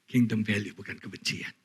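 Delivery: ordinary delivery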